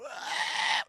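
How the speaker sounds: loud, voiceless strangled scream; hammed-up scream of agony; strained scream. [name] depressurizing, acted.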